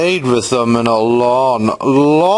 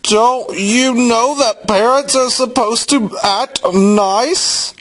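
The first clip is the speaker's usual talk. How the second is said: high, indignant scolding without much volume; lightly voiced child telling off the grown-ups; mild mock yell; 11 semitones higher than usual. A narrator's reading. Girl lecturing her parent dolls, indignant mild yell. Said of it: "she yelled at them".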